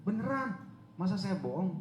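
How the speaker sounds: high-pitched retold protest; emphatic insistence. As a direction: imitate a friend insisting they mean it. (meyakinkan dengan nada tinggi)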